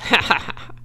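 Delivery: short breathy chuckle; low chuckle. A narrator's reading. Rat about to bite the pig's tail, short chuckle. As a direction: chuckle to yourself. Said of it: "he chuckled".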